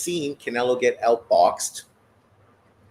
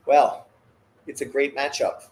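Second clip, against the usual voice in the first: ordinary talk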